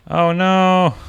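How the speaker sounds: high, loud cry of dismay. Real, not acted. Player reacting to a mishap in game, dismayed cry. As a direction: cry out as it goes wrong.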